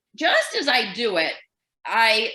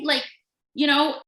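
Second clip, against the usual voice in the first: usual speaking voice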